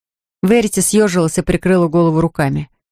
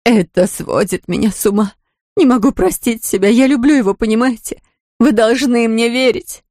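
distraught pleading; 4 semitones higher than usual. Acted. Distraught sister's outburst.

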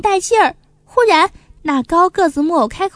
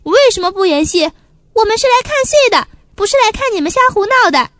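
angry; 6 semitones higher, 5 dB louder, faster than usual. Acted. Audience protests the chaos.

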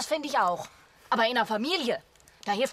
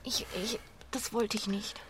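guilty whisper; 10 dB quieter than usual. Acted. Regret after the quarrel.

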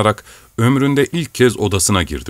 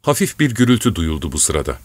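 usual speaking voice

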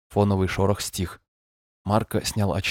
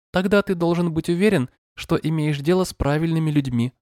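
gentle but monotone synthetic voice, higher; screen reader voice; 8 semitones higher than usual. Acted. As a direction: read it like a calm machine voice. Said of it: "звучал ласково, но монотонно".

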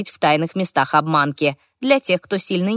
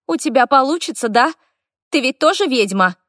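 put-on eager boy; 7 semitones higher than usual. Hopeful boy voiced.

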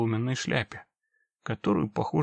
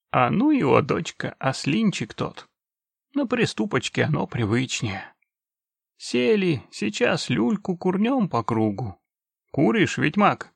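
put-on very old man; 6 semitones higher, 4 dB louder than usual. Old orc grandpa speaking.

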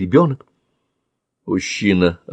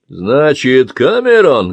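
higher, louder taunt; menacing gloat; 4 semitones higher, 6 dB louder, slower than usual. Acted. Man mocks the boy's name, menacing gloat. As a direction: savour the name as a threat.

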